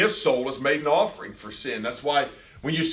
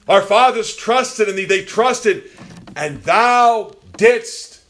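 very high, loud impassioned proclamation; full-force preaching; 9 semitones higher, 7 dB louder than usual. Acted. Preacher voicing praise to the holy God amid suffering, full-force preaching. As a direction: belt it out from the pulpit.

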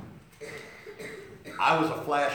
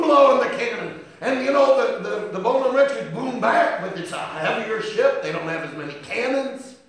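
loud, higher, driving sermon cry; a full-throated preacher's build; preaching at full force; 8 semitones higher, 10 dB louder than usual. Acted. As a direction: thunder it out from the pulpit.

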